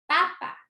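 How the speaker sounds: "papa" with first PA high and strong; stress on first syllable. Shown as a demonstration. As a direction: push the first PA of "papa" up in pitch. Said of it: "escuchen esa mayor fuerza de voz". ("papa" con la primera PA alta y fuerte)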